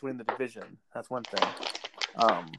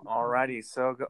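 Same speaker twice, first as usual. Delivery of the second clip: ordinary talk, nothing marked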